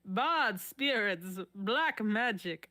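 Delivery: put-on slow, drawn-out Mexican accent; bad Mexican accent. Patient warning the doctor of devils, in a slow Mexican accent. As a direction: linger on each word in a put-on Mexican accent. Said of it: "Should I do a bad Mexican accent?".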